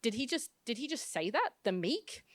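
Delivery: hushed, incredulous questioning with swooping pitch; scandalized, softly spoken double take; shocked disbelief. Acted. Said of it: "suddenly turned to gasps of shock".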